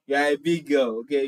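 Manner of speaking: usual speaking voice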